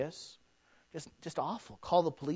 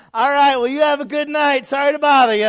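loud, high, hammed-up polite send-off; exaggeratedly polite holler; called-out goodbye; 9 semitones higher, 13 dB louder than usual. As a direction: call it out over the noise. Acts calling out polite goodbye.

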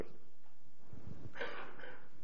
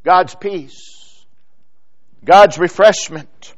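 usual speaking voice

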